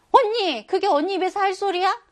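놀라서 높고 크게 따지는 목소리. Acted. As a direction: call out in shock at an older sister, high and loud. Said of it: "놀라서". (깜짝 놀라 따지듯)

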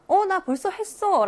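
drawn-out, high-pitched friendly acting; nicer answer. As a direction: model an agreeable answer to a parent. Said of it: "라고 하면 되잖아".

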